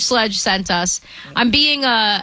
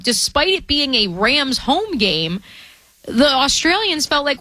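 usual speaking voice

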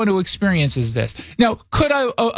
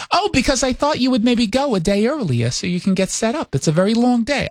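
higher-pitched New York mom impression; mother's New York accent; 5 semitones higher than usual. Mother advises son on travel, high New York accent. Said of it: "Does your mom actually have a New York accent".

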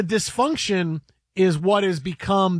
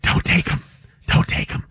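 stage whisper. Acted. Host playing a whisperer warning a coach off a player, stage whisper. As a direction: whisper the warning over and over, mock-secretive. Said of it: "he needed someone to whisper to him".

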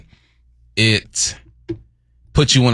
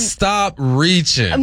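sing-song retort; 8 semitones higher, much slower than usual. Pretend. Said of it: "repeats retort, higher and slower".

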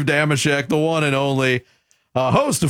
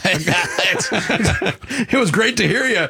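laughing; 3 semitones higher, much slower than usual. Real, not acted. Host joking at sign-off.

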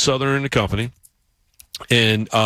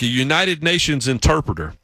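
usual speaking voice

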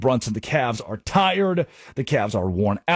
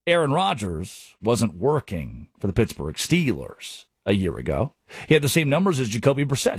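usual speaking voice

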